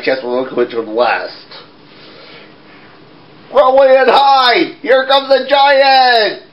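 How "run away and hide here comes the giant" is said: high, slow alarmed call. Acted. Jack warns everyone the giant is coming, high alarmed call. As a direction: shout the warning up high and slow. Child playing Jack calls out.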